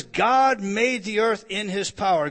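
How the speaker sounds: "God made the earth" loud but at normal pace; firm loud restatement of "God made the earth"; quoted scripture. Acted. Quoting the verse again.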